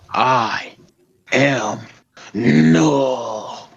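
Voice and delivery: very slow monster voice, long stretched words; drawn-out villain declaration; Null. Acted. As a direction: hold each word, swelling on the name. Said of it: "Something like that".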